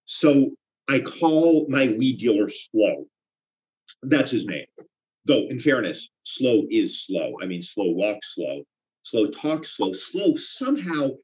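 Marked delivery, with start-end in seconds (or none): 0.0-3.0 drawn out. Acted. Setting up Slow joke. 6.2-9.9 slow drawl. Acted. Mimicking Slow's slowness.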